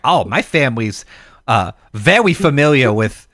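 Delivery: put-on babyish puppet lisp with r's and l's as w's; mock cartoon speech impediment; Elmer Fudd sound. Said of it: "Elmer Fudd sounding motherfucker".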